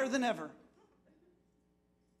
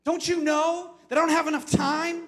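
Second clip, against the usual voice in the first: loud, higher exasperated outburst; mock complaint; 8 semitones higher, 8 dB louder than usual. Congregation grumbling at their pastor, mock shouted complaint. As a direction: throw it out like a fed-up churchgoer. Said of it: "They're saying".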